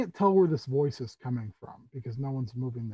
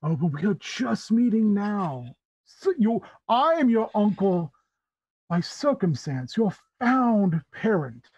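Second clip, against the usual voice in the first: higher, lilting theatrical host, softening at the end; courtly Tabaxi uncle; 5 semitones higher than usual. Acted. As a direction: explain with grand, measured courtesy. Tabaxi host introduces himself.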